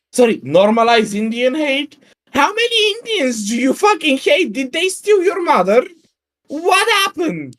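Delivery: high-pitched, exasperated disbelief; incredulous outburst. Real, not acted. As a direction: demand an answer in baffled, high-pitched outrage. Incredulous rant at reported hateful tweets.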